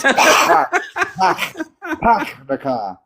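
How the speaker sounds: laughing, hammed-up Boston accent; mock Boston accent. Shown as a demonstration. Host mocks the Boston accent, laughing. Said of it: "sounds like they're choking on a hairball".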